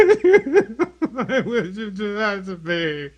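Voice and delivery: mock hurt. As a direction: play wounded while laughing. Mock hurt after being raged at.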